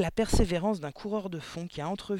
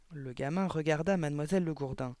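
ordinary delivery

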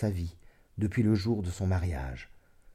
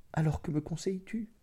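higher, softer, mildly anxious voice; uneasy question; 8 semitones higher, 5 dB quieter than usual. A narrator's reading. Woman asking her lover for advice, mildly uneasy. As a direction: ask it softly, with worry in it. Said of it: "en lui jetant un coup d'œil inquiet".

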